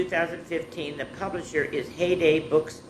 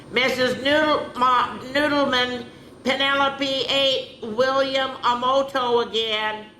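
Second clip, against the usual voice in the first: put-on higher tattletale kid voice; a classmate tattling to the teacher; tattling child; 8 semitones higher than usual. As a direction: tell on her to the teacher in a higher voice.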